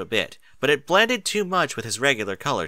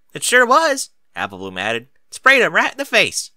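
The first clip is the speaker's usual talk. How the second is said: much higher and louder, squealing little filly; excited filly; 10 semitones higher, 7 dB louder than usual. Acted. Apple Bloom gleefully confirming the skunk story, high and loud. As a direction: blurt it out like an eager kid sister.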